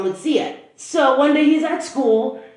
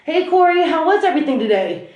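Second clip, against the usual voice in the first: higher, upbeat imitation of a dad; cheery greeting; 6 semitones higher, 3 dB louder than usual. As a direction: act out a breezy parent coming home. Dad comes home, greets his son.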